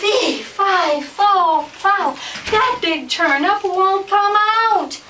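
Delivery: high, loud, drawn-out chant; shouted story refrain. Acted. Family and animals still stuck, high shouted chant. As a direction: belt it out word by word, slowly.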